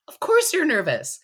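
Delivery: rally nervous competitors with a high, loud push; impassioned reassurance. Real, not acted.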